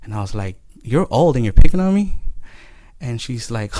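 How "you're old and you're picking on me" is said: indignant retort. Retold or imitated. Child retorting to the lady.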